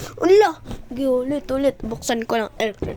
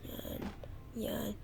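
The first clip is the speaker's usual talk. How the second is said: ordinary talk, nothing marked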